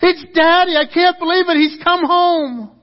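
put-on high, loud scream of a thrilled little girl; acted daughter yelling for her mother; excited child's scream. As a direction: shriek it joyfully at the top of your lungs, drawing out the end. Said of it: "she begins to scream at the top of her lungs".

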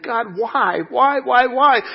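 high, rising cry of why; anguished prayer. Retold or imitated. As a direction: plead with God in pain. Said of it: "and I'm saying".

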